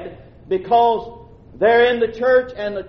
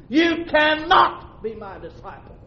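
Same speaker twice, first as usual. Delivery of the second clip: preaching shout; shouted repeat; 7 semitones higher than usual. Acted. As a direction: shout the warning out to the church.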